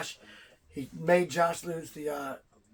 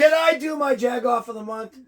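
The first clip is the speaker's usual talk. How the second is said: louder, pushing to be heard; insistent shout; 4 semitones higher, 7 dB louder than usual. Real, not acted. Host cuts in on his co-host, shouting to be heard. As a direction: demand the floor, no waiting.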